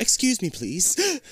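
put-on lightly posh British accent; polite mock British accent. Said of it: "worst British accent ever".

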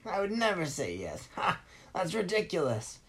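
villain gloating over his refusal; mocking villain refusal. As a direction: taunt the boy with each no.

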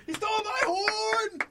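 high, slow drawn-out yell; yelling again. Acted. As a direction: call out the alarm. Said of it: "I'll yell as loud as I can".

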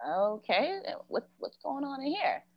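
softly ask, creeping into something odd; wary puzzlement. Retold or imitated. Walking toward creepy strings, hushed wary puzzlement.

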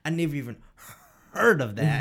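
mock outrage, bursting out; comedian impression. Friend imitating comedian hearing a fish name, mock disbelief. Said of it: "The one I showed you last week".

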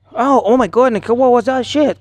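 mock dramatic English-language actor; English-dub original line. Friend parodying an English series line, exclaimed. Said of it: "Porque en inglés".

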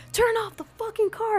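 high-pitched yell held back in volume; retold cop yell. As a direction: imitate a cussing cop's command. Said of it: "they're cussing".

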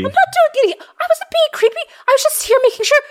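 small creature, nervous and defensive; high, quick little gnome voice, x1.5. Acted. Gnome denies being creepy.

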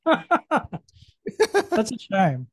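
slow laugh through the words; laughing it out slowly. Real, not acted. Laughing at chalky cookie.

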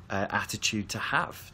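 ordinary talk, nothing marked

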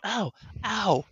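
high, pained little ow, ow, ow; imitating crying toddler. Mother imitating her toddler crying during stitches, high pained ows.

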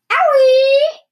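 high, loud yelp of sudden pain; yelled cry of pain. A narrator's reading. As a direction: shriek it like a sudden jolt. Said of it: "Grandma yelled".

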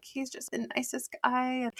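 put-on 3 play a starry-eyed girl, lightly; 4 a hint of a lovestruck fan; 1 mock smitten girl. Mocking girls charmed by him.

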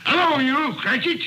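pretend-stern summons; mock gruff call. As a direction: bark his name to startle him. Scrooge pretends to scold clerk.

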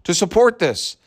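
mocking the tweeter. Vlogger mocking a tweeter's opinion, high sneering voice. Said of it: "If he had said".

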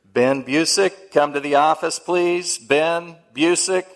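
loud, slow school PA call; drawn-out loudspeaker summons; intercom announcement. Retold or imitated. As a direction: boom it out like the principal on the intercom. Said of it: "it went something like this".

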